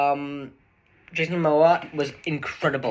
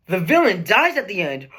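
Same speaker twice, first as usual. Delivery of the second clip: hammed-up shocked announcement, louder; mock dramatic reveal; 4 semitones higher, 6 dB louder than usual. Shown as a demonstration. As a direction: deliver it like a big twist. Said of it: "This might be a little shocking".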